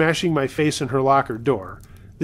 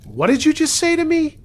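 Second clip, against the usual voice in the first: raised, much higher indignant demand; really loud voice; 14 semitones higher, 4 dB louder than usual. A narrator's reading. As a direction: narrate a mother raising her voice at a caller. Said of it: "in a really loud voice".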